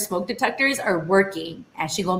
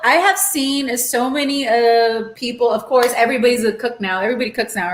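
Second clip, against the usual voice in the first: ordinary talk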